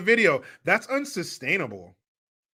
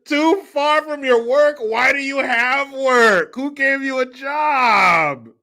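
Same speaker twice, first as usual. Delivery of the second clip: mock outrage; 6 semitones higher, 5 dB louder, slower than usual. Mocking outrage at complaint.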